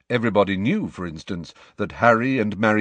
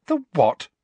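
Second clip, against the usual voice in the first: higher, sharp retort; 9 semitones higher, 4 dB louder than usual. A narrator's reading. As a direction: snap the question. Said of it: "said Hermione sharply".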